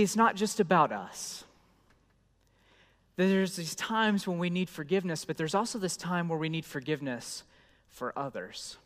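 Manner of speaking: ordinary delivery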